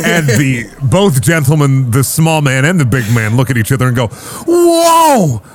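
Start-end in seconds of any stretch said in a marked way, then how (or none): 4.5-5.4 amazed. Acted. Merchants amazed by Charybdis.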